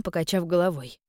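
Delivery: ordinary delivery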